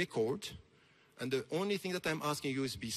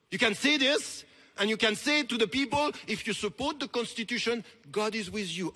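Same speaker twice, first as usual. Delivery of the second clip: loud, high, indignant challenge; fervent podium accusation; impassioned rebuke; 6 semitones higher, 5 dB louder than usual. Real, not acted. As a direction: confront him in raised-voice disbelief.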